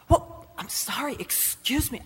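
halting retold apology; flustered, broken-up apology; shocked stammer. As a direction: stumble over the words, taken aback. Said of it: "I was so shocked".

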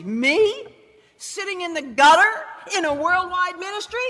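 retold disbelief, pitch leaping up; high, incredulous questioning. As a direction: question it as if it is absurd. Retelling her disbelief at God's call.

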